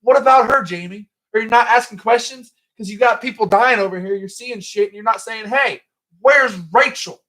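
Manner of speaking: high, loud exasperated rant with a stutter; mock indignant demand. Podcaster grilling Jamie about missing Rachel, high mock indignation.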